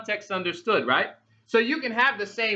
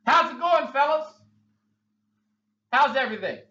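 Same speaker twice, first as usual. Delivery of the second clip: Jesus greeting; 5 semitones higher than usual. Acted. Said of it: "Jesus walks up and says".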